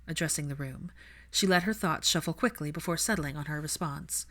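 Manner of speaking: plain reading voice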